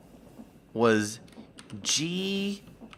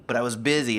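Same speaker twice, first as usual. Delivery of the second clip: normal talking voice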